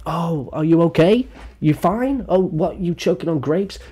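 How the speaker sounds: high-pitched questioning, lightly put on; mock concern. Parent asks choking son, mock concern. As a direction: lilt upward with feigned worry.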